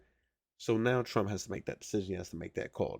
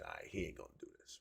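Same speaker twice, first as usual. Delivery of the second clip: dismissive quote; 2 semitones lower, 13 dB quieter, much faster than usual. Retold or imitated. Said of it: "ninety percent of us said".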